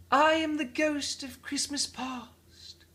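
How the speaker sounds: high, slow unearthly voice; ghostly spirit voice. Acted. The spirit introduces itself.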